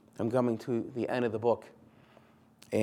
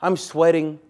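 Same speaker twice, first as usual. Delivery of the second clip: rising, louder mock grumble of an overworked sister; indignant Martha; 5 semitones higher, 6 dB louder than usual. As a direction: huff about lazy Mary, climbing in pitch and volume. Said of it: "Martha's sitting there going".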